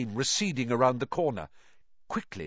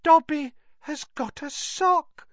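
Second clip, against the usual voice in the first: very high, disbelieving elf; high elf voice, amazed; Dobby in disbelief; 4 dB louder than usual. A narrator's reading. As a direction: squeak it in stunned disbelief. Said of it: "said Dobby in disbelief".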